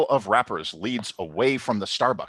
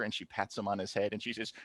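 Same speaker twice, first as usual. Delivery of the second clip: normal talking voice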